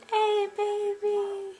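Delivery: high, slow cooing. Real, not acted. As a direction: coo it to a sleepy pet. Cooing to sleeping cat.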